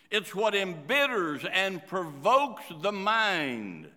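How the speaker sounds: ordinary delivery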